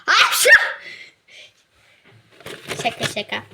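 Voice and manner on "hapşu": laughing fake sneeze, louder; a second mock sneeze with a giggle; sneezing. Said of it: "Değil". (gülerek sahte hapşırık)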